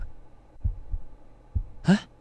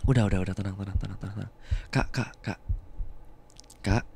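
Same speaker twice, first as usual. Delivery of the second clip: low, hushed calming; soothing; 7 semitones lower, 5 dB quieter, faster than usual. Acted. Comforting a panicked partner in a low, soft soothing voice. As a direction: gently settle her down.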